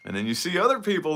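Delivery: ordinary talk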